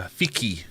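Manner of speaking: FIKI said with a hard k, "fee-kee"; hard-k guess. Shown as a demonstration. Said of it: "kinda looked like fishy".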